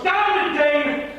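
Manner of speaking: loud, high, raging cry; furious accusation. Acted. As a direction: spit it at him in fury. Laertes accuses the king.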